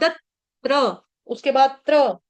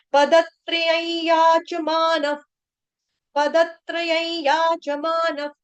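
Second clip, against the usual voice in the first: verse recital; 4 semitones higher, much slower than usual. Acted. Recites full verse line.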